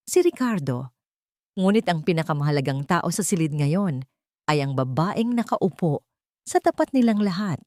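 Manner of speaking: ordinary delivery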